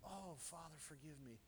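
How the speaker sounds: a soft, remorseful murmur to God; crushed prayer. Retold or imitated. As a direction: breathe it out as if heartbroken. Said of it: "I was crushed in my spirit".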